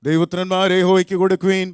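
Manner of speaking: high, loud psalm reading; scripture recitation. Acted. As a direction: proclaim the psalm to lead a congregation. Leads responsive psalm reading.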